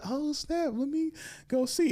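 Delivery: eager excuse to check her tattoo, high; mock eager. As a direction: jump at the chance, voice way up.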